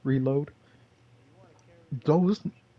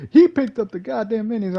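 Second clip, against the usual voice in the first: laughing; 9 semitones higher, 7 dB louder than usual. Real, not acted. Laughs at teammate's move.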